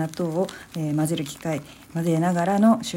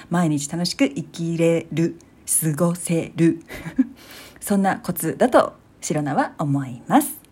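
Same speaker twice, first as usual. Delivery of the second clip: normal talking voice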